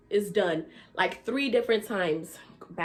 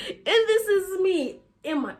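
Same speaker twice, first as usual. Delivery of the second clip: laughing; 9 semitones higher, 4 dB louder than usual. Real, not acted. Laughing while showing outfit.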